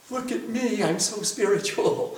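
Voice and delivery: softly self-satisfied, low with a laugh; mock boasting. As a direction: play the smug devotee lightly. Mocks the self-satisfied devotee.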